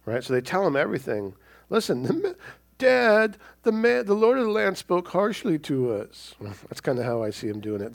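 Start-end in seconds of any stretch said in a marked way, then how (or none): 1.7-6.3 high whiny complaint; whining to Dad. Retold or imitated. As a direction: kids tattling to their father. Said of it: "they're whining to Dad".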